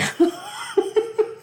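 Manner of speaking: high breathless giggling fit; helpless giggle. Real, not acted. Laughing at the co-host's eye roll, a high breathless giggle. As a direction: collapse into real giggles. Cracking up at co-host.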